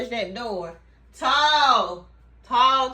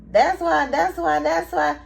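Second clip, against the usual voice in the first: mimicking him; 6 semitones higher, 4 dB louder than usual. Mimicking the man at the table.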